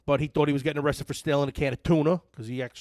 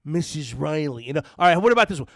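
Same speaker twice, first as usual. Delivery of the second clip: usual speaking voice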